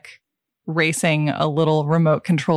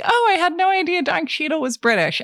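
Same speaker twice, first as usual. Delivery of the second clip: higher-pitched retold realization; lightly acted "oh, who knew" reaction, voice up; mock naive surprise; 11 semitones higher than usual. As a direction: play your past self, innocently surprised. Retells own naive first reaction.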